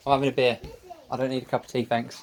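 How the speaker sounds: loud call across the house. Real, not acted. Man calling to his wife he's having a beer. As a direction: shout it to the next room.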